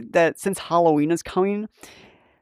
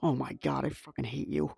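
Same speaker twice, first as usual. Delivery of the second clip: softer, half-laughing grumble; muttered groan; 4 semitones lower, 13 dB quieter than usual. Retold or imitated. Worker groaning at the supervisor's leaf pun, soft mutter. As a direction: mutter it under your breath. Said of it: "I'm like".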